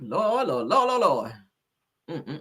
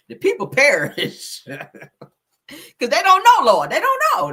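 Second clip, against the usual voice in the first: slow, drawn-out and louder, a preacher's wail with a laugh in it; mock preaching; 8 semitones higher, 6 dB louder, slower than usual. To her viewers about the flyer, mock preaching slowly. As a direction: stretch each word out like a sermon from the pulpit.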